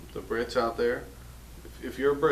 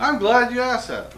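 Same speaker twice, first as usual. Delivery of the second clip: loud, high presenter's reply; showy eagerness; 11 semitones higher, 8 dB louder than usual. Pretend. Host answering the cameraman's question, loud showy eagerness. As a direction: pounce on the question, pitched up and loud.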